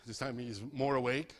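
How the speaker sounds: ordinary delivery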